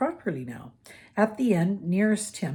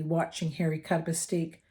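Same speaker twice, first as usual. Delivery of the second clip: usual speaking voice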